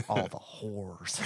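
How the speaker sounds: slow, stretched mock old lady line; drawn-out old lady catchphrase. Friends quoting the old lady's line, slow and drawn out. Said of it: "We just say that all the time".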